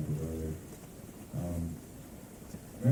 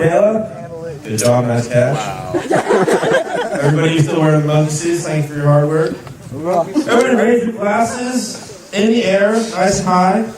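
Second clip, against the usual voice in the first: wedding toast speech; 11 semitones higher, 16 dB louder, slower than usual. Real, not acted. MC toasting, raising glasses.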